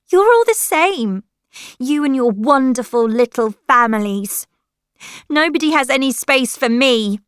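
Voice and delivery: high, loud wounded tirade; angry shouting. A narrator's reading. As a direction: lash out at the whole family. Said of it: "shouted Lily".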